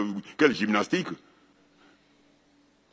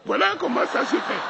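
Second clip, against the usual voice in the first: laughs through "Voilà comment ça se fait", pitch jumping high; amused high laugh on "Voilà"; chuckling delivery; 12 semitones higher than usual. Real, not acted. Laughing first take.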